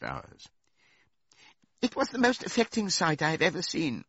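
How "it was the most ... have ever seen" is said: high-pitched, affected pig voice; put-on high voice, making a show of grief; tearful pig orator. A narrator's reading. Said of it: "said Squealer, lifting his trotter and wiping away a tear".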